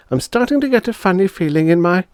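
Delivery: put-on high, uneasy girl; a little girl getting worried; girl's voice. Sister feeling the change begin, high uneasy girl. Bonnie's lines.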